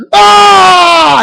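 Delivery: high loud wailing shout of a tantrum; imitated tantrum cry. The disappointed boy throwing a fit, loud wail. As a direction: burst out like a frustrated child.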